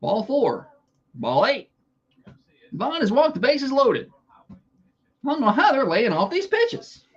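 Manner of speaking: high baseball play-by-play call; Bob Uecker. Retold or imitated. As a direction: call the walk like a radio announcer. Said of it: "Bob Uecker as Harry Doyle".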